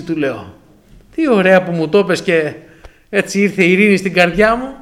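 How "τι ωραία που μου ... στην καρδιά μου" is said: mock delight. Mock peace at the scam.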